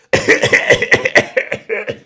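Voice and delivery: exaggerated mock cough fit; hammed-up cough; fake coughing. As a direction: cough all over the produce on purpose. Acted coughing over produce.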